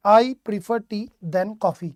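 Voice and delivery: very slow, word by word; slow dictation. Shown as a demonstration. Teacher reading a sentence slowly to the class. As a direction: read it out slowly for students to spot the error. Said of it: "क्या कहोगे यहाँ पे?".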